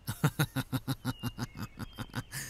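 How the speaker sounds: a scornful laugh at the prophet's naivety; mocking laugh. Townsman mocks Lot's naive preaching, scornful laugh. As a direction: snicker at the preacher's foolishness.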